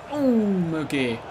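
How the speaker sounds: high, slow drawn-out gasp; surprised gasp. Real, not acted. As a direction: gasp as the ball goes wide. Near chance.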